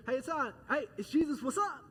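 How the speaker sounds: high, loud, chummy hey-there; breezy casual greeting. Pretend. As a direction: toss it off like greeting a buddy. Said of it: "it's not that casual".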